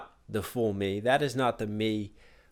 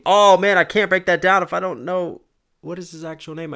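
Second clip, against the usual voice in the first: higher and louder, bursting out; excited exclamation; 7 semitones higher, 7 dB louder than usual. Real, not acted. Reactor stumped by a lyric, excited and louder. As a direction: blurt it out in eager frustration.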